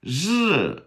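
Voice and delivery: "日" high, drawn-out zh-like R, falling; ri sound demo. Shown as a demonstration. Teacher asking learners to reproduce it, high buzzed "日". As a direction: buzz "日" between sh and R, pitched high. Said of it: "reproduce it?".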